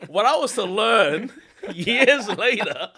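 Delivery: high, loud words broken by laughter; laughing while telling. Real, not acted. Storyteller to co-host about a meeting, laughing. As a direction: drag it out through a laugh.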